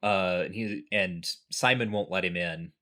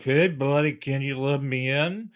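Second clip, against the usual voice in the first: put-on higher, louder, pleading drunk at the door; drunk guy; 6 semitones higher, 6 dB louder than usual. Drunk asking the door guard to let him in, loud and pleading. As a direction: lightly play a drunk begging to get in. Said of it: "He is just a drunk".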